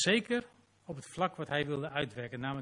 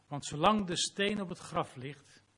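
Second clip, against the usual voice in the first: ordinary talk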